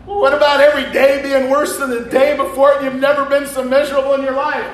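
high, loud, laughing voice, fast at the end. Real, not acted. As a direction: ask it while laughing. Wife's question quoted while laughing.

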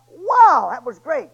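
high, loud wow then settling; delighted wow. Retold or imitated. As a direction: exclaim with pleasure. Samson tastes again.

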